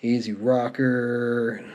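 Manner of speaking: ordinary talk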